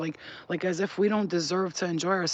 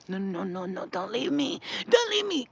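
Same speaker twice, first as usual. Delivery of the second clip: clingy entity. Retold or imitated. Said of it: "suck you in one last time".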